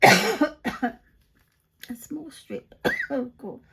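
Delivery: coughing while choking on saliva; words through a coughing fit. Real, not acted. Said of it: "I'm choking on my saliva".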